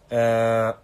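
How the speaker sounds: loud, drawn-out mimicking drone. Streamer showing viewers a friend's habit, loud drawn-out impression. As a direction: imitate a friend with one long loud "eh". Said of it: "タカの真似はね".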